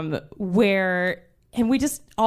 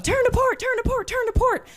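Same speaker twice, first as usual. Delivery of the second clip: repeated calls, much higher, echoing down the deck; relayed shouts. Retold or imitated. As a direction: pass the shout along like a chain of crew. Order relayed down the ship.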